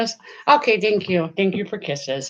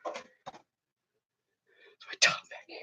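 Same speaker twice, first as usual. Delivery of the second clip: whispered warning to the dog; 15 dB quieter, faster than usual. Real, not acted. Owner whispers a warning to her dog. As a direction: hush it so the stream barely hears.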